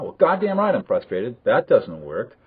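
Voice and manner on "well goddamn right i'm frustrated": higher-pitched mock indignation; irritated retort. Teacher acting someone's reply to being asked if frustrated, mock-irritated retort. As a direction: fire back, mildly exasperated.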